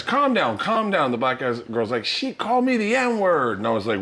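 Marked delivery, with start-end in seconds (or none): 0.0-1.1 retold plea, pitched up on each "calm"; urgent calling. Doorman to fighting girls at the entrance, urgent. 2.0-3.6 high, slow, drawn-out mock complaint; indignant accusation. Girl accusing another to the doorman, indignant. Girl's accusation in retold fight.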